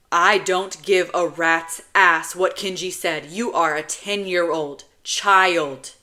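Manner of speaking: loud, emphatic scolding; forceful big-brother rebuke; stern anger. Acted. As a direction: lay down the law to a child. Older brother angrily forbids child.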